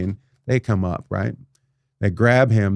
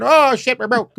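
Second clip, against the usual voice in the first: mock panic; 13 semitones higher, 4 dB louder than usual. Mocking the broke builder.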